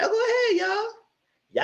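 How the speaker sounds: squeaky high laughing taunt; laughing mock cheer. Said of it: "I said".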